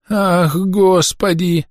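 put-on high, drawn-out old woman's wail; tearful lament. A narrator's reading. Old woman cries to God after stones hit, tearful lament. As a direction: wail plaintively to God, drawing it out. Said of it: "плаксиво причитала бабка".

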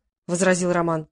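ordinary delivery

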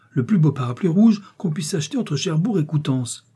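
high-pitched, delighted promise; joyful exclamation. A narrator's reading. Daughter promising her mother the finest gift, joyful. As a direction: let delight lift the pitch. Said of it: "s'écria Geneviève, rayonnante de joie".

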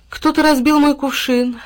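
high, loud, put-on plaint; embarrassed. A narrator's reading. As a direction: complain in a flustered character voice. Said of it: "сконфуженно произнесла Молоко". (сконфуженная жалоба)

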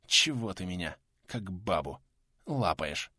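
low, weak hoarse gasping; breathless wheeze. A narrator's reading. Sick pensioner pushes his helper away, wheezing breathlessly. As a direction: push out short phrases, short of breath. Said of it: "задыхаясь, прохрипел трудовик".

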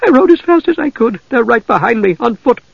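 put-on a frightened girl telling of her father's death; panicked farm girl. A narrator's reading.

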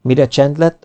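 ordinary delivery

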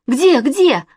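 high, loud frantic question; jumpy repeated question; alarmed. A narrator's reading. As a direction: search in a panic. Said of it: "вдруг всполошился Костя".

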